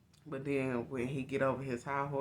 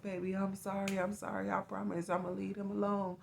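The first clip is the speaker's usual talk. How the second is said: higher, mock-pleading apology; lightly voiced begging ex, higher; pleading boyfriend; 6 semitones higher than usual. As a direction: imitate his fake sorry voice. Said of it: "will literally be like".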